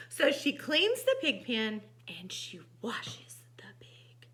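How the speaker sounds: animated storybook reading; lively storytime voice; story narration. A narrator's reading. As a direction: read to children with big emphasis on key words. Reads picture book aloud.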